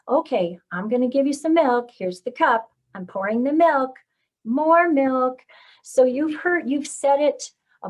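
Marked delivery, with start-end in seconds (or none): 0.1-5.4 "milk" lifted and stressed each time; louder child-directed tune with emphasis on "milk"; modeling with intonation. Shown as a demonstration. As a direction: narrate to a toddler, making "milk" stand out. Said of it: "used a lot of intonation in your voice".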